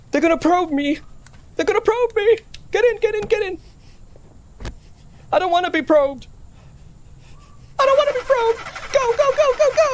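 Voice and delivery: high, frantic yelling while fleeing; panicked. Real, not acted. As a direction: scream it in real panic.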